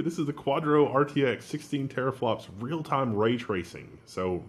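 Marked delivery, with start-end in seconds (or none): none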